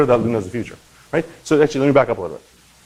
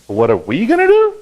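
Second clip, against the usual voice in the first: much higher, louder outraged retort; indignant comeback; 11 semitones higher, 5 dB louder than usual. Retold or imitated. Government answering the founder's taunt, mock outraged. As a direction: fire it back as the offended authorities. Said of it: "And they're like".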